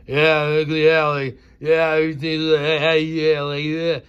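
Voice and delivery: loud, empty repeated yeahs; mocking dumb yes-man. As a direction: parrot him like a shallow moron. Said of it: "Just the tone of his voice shows".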